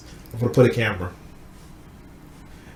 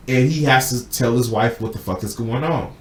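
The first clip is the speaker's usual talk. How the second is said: ordinary talk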